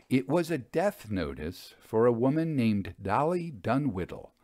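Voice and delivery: plain reading voice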